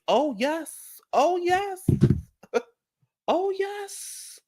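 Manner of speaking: high sing-song oh yes; giddy, high-pitched victory chant; gleeful high cheer. Real, not acted.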